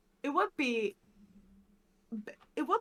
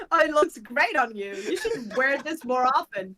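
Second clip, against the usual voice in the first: loud, higher-pitched imitation of flattery; mock gushing compliment; 3 semitones higher, 9 dB louder than usual. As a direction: gush like an admiring friend. Acting out someone complimenting a hairstyle.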